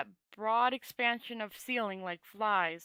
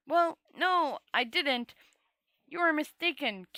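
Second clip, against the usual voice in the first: high protest; 6 semitones higher, 5 dB louder than usual. A narrator's reading. Rincewind protests to the Dragon Lord.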